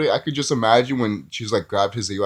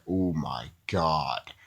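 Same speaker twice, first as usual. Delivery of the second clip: slow, quiet, drawn-out dismay; exasperated oh my God; 7 semitones lower, 6 dB quieter, much slower than usual. Retold or imitated. Reviewer reacting to Lex Luthor's actor, quietly exasperated. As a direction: let it sag out in disbelief. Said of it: "there's things about him that's like".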